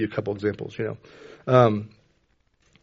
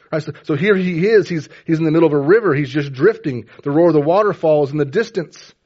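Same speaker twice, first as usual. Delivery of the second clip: loud, raised preaching voice; impassioned preaching; 4 semitones higher, 8 dB louder than usual. Acted. Preacher retelling the psalmist's plight to the congregation, loud and impassioned. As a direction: preach it out forcefully over the room.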